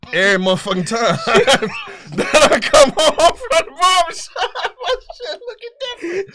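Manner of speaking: laughing hard through the words; cracking up while telling it. Real, not acted. Telling a friend the joke, laughing through it.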